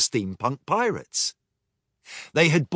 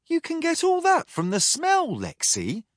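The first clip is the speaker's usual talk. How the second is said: put-on much higher young voice; cabin boy. Cabin girl asks Lexi in wonder, high young voice. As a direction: ask in awe like a youngster. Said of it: "asked Pendle, the cabin boy".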